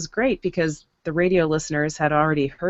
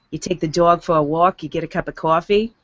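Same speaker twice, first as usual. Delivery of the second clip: New York vowels on dog and coffee; New York accent. Shown as a demonstration. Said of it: "you might have a New York accent".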